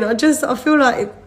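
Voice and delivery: usual speaking voice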